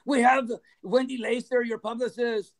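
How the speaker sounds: mock kidnapper making an announcement; imitated menacing caller. Promoters phoning at three in the morning, mock kidnapper voice. Promoters' ransom call, retold.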